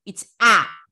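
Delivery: آ held long and open; long aa vowel. Shown as a demonstration. Teacher showing pupils the long آ sound. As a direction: stretch the آ, a long aa. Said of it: "It's not حركة like فتح".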